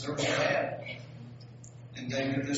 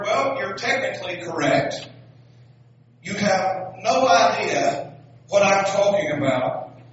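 loud, slower punchline, rising higher at the end; calling up; 6 semitones higher, 11 dB louder, slower than usual. Acted. Lady shouting the punchline up to the balloonist, loud.

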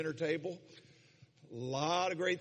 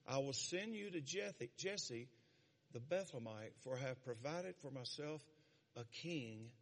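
scripture reading; 4 semitones lower, 10 dB quieter than usual. Acted. Reads God's words from scripture.

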